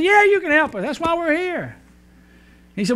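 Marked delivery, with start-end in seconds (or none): 0.0-1.8 much higher wife voice; wife answering the attendant, much higher; hard-of-hearing wife. Acted. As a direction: pitch her up into the wife's voice.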